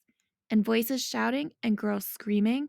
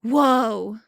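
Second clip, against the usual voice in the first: whoa; 8 dB louder than usual. A narrator's reading. Awed exclamation.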